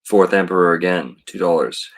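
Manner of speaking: normal talking voice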